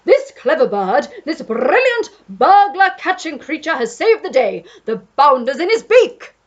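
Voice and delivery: put-on loud blustering old Duke boasting; proud Duke. A narrator's reading. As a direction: proclaim it grandly. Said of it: "He pointed to the Pelican and said".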